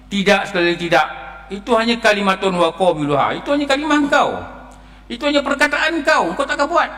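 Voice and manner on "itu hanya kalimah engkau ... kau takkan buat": higher, firm rebuke of the dead man; a firm voice refusing the plea; stern divine rebuke. Acted. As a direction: declare it flatly, no way back.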